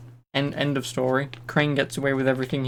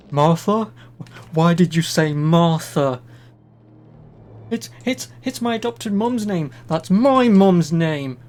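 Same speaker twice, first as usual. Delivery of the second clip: loud hammed-up movie outburst, pitched up; mock Martha shout; 4 semitones higher, 8 dB louder, slower than usual. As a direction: bellow it like the film's melodramatic hero. Acting out the Martha movie scene.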